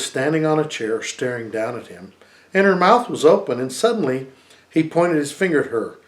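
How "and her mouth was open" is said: ordinary delivery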